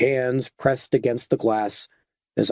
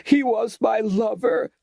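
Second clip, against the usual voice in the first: hysterical; 11 semitones higher than usual. A narrator's reading. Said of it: "off-key and hysterical".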